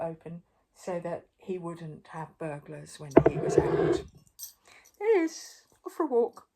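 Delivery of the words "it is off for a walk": ordinary delivery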